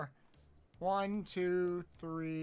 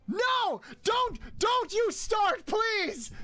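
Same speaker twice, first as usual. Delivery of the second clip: high, loud pleading protest; begging them to stop, shouting high; panicked shout; 13 semitones higher, 4 dB louder, much slower than usual. Real, not acted. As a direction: yell it in mock panic, much higher. Chat teases, streamer protests.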